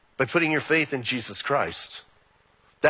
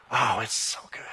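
softer, lower and hushed with feeling; tender; 7 semitones lower, 5 dB quieter than usual. Real, not acted. Pastor recalling the bride entering, soft and tender. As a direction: savour it like a treasured moment.

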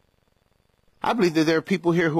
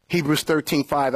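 ordinary talk, nothing marked

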